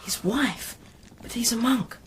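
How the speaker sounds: ordinary talk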